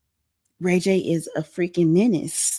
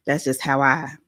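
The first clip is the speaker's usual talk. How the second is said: ordinary talk, nothing marked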